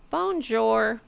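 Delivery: high, sing-song bonjour; mock French greeting. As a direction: imitate her greeting in a drawn-out singsong. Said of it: "she goes".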